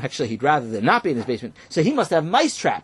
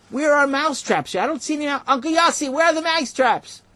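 high calling out; 12 semitones higher, 3 dB louder than usual. Acted. Boy calls up from basement.

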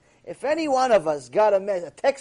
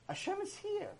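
hushed; 11 semitones higher, 13 dB quieter than usual. Shown as a demonstration.

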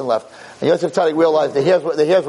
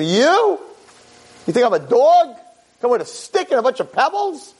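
giant's taunt; 6 semitones higher than usual. Acted.

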